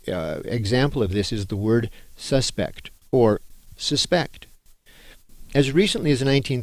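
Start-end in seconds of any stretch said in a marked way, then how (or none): 2.2-2.9 "suspect" stressed on the first syllable, SUS-pekt; noun pronunciation. Shown as a demonstration. Teacher contrasting two words, SUS-pect as the noun. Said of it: "the other pronunciation of the word". 3.8-4.5 "suspect" stressed on the last syllable, suh-SPEKT; verb pronunciation. Shown as a demonstration. Teacher contrasting two words, sus-PECT as the verb. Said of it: "the other pronunciation of the word".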